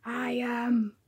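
slow unvoiced villain whisper; slow menacing whisper. Villain whispers to the hero that he is not Gargaroth. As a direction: breathe it out slowly, menacing.

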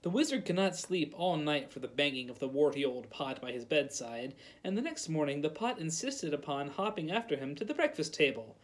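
ordinary delivery, nothing marked

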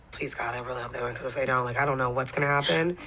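low, soft pleading under the breath; hushed prayer. Retold or imitated. Guest retells praying the team wins, hushed pleading. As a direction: act out a private, worried plea. Retold anxious private prayer before the win.

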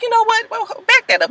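high curious double take; wait, rewind, perked up; noticing something. Retold or imitated. Vargas brother spotting the agent, high surprise. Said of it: "He's like".

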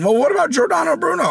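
high-pitched mocking challenge; exaggerated high voice of a heckler; mock objector. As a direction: whine it like a silly objector.

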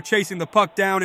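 usual speaking voice

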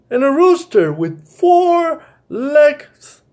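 put-on high, slow child's voice in wonder; a small boy recalling a dream, wide-eyed; dreamy child voice. Kenny telling about his dream, high slow wondering child.

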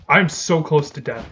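ordinary delivery

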